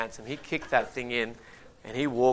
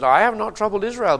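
higher and much louder, ringing out; forceful denial; 9 semitones higher, 10 dB louder than usual. Acted. Elijah answering Ahab's accusation, loud and forceful. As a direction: fling the denial back at the king. Elijah's retort read from scripture.

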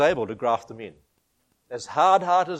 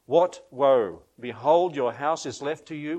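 preaching; 2 semitones higher, 5 dB louder than usual. Acted. Preaching scripture with force.

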